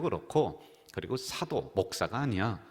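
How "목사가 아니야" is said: ordinary delivery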